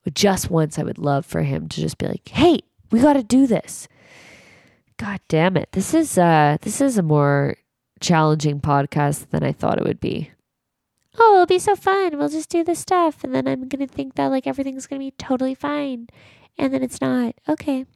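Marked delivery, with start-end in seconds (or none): 2.3-3.9 mildly acted pep call, higher; rally someone in a higher put-on voice; imagined rallying call. Voicing what he should say, imagined rallying call. 11.2-17.8 high, sing-out parody of blithe hopes; mock your own expectations in a high voice; mock naive optimism. Mocking how easy the podcast seemed, mock naive optimism.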